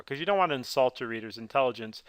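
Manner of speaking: ordinary talk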